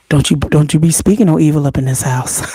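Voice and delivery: wag the finger at her daughter; mock stern warning. To her daughter, mock stern and low.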